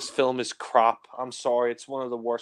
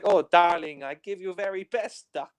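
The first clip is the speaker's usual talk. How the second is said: mock Japanese girl; 5 semitones higher, 3 dB louder than usual. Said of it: "She's like".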